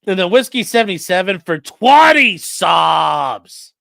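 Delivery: loud, slow announcer shout for the donation; big excited hype call. Real, not acted. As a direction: bellow it like a ring announcer.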